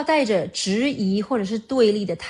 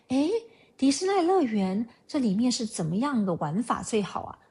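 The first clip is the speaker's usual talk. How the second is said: gently curious question, lightly lilting; calm, curious; 3 semitones higher than usual. Shown as a demonstration. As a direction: ask calmly with open, unchallenging curiosity. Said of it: "就是这样子，平静、好奇的语气去问". (平静、好奇的语气)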